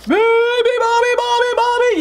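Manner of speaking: high, loud hippo yell. A narrator's reading. Hippos wake the sleeping bird, yelling. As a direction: yell the nonsense chant. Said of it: "yelled the hippos".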